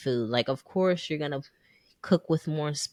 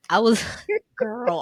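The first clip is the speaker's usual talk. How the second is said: ordinary delivery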